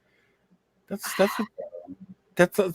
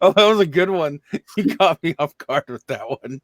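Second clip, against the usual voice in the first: breathless after a big laugh; after laughing hard. Real, not acted. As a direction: talk while still catching breath from laughing. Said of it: "my mouth hurts from laughing".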